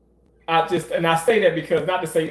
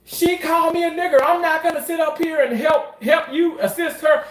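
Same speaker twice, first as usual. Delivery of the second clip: much higher, half-shouted refusal; mock outraged protest to the surgeon; indignant refusal; 11 semitones higher than usual. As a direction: huff it out, offended. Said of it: "be like".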